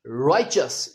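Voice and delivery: राइचस with ch in the middle; correct pronunciation. Shown as a demonstration. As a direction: say राइचस as rai-chus. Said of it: "ये है इसका सही उच्चारण". (राइचस, च के साथ सही उच्चारण)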